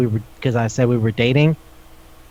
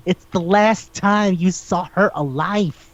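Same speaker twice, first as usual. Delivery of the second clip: slow emphatic; 4 semitones higher, slower than usual. Real, not acted. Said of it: "rant about the witness".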